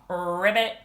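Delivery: low ribbit with rolled start; frog croak. Pretend. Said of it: "Ribbit your song".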